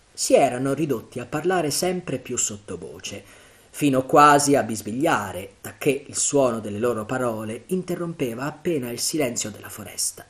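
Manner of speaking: usual speaking voice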